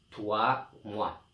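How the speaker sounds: usual speaking voice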